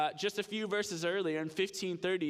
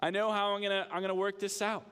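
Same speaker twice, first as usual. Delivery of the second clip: higher-pitched burst of realization; sudden epiphany; 4 semitones higher, faster than usual. Acted. Manager has an epiphany about his plan, emphatic realization. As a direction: act out the idea suddenly landing. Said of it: "it's emphatic. It's like it gets to him".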